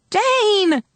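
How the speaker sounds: very high, sustained cry; scream for help. A narrator's reading. Girl screaming for Jane as her baby is taken. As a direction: scream the name, held out. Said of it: "I screamed".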